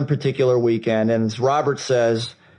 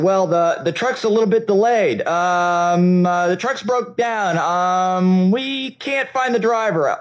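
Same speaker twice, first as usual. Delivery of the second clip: high, hesitant made-up excuses, voice climbing higher; stalling lie; 6 semitones higher, slower than usual. Retold or imitated. Owner lying to customers about the truck, nervous high stalling. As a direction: fumble out a cover story to buy time. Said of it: "I lied".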